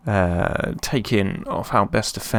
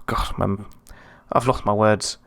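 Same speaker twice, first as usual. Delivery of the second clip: normal talking voice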